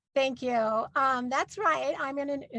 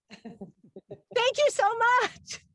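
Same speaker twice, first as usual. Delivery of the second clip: gushing thank you; 10 semitones higher, much slower than usual. Real, not acted. Closing to audience.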